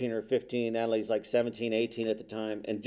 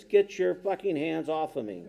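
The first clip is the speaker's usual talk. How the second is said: imitated higher-pitched angry demand; angry outburst; 5 semitones higher than usual. Hurting son to father at his bedside, angry outburst. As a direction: act out a son lashing out at Dad.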